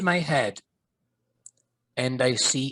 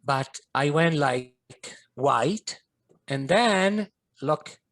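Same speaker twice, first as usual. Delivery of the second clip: ordinary talk, nothing marked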